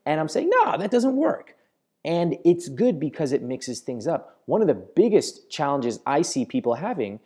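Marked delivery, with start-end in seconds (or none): 0.5-1.5 high, drawn-out expressive dismissal; slow it and lift the pitch right up. Shown as a demonstration. Coach replaying his TV rebuttal, high-pitched. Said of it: "using a lot of really high-pitched tone".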